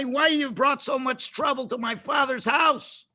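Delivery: indignant reproach. Acted. Pharaoh's daughter scolds Moshe over her father's house, indignant and high. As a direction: berate someone for their ingratitude.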